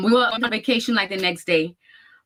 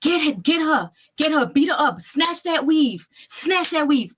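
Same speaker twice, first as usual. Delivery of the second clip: higher, imitated yelling of the fight cheer; retold screaming; 7 semitones higher, slower than usual. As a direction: shout it like egging on a brawl. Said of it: "the daughter was screaming".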